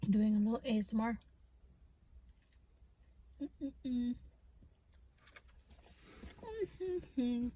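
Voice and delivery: talking through a mouthful; words around a bite of food; mouth full. Real, not acted. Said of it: "sorry if I'm eating too fast".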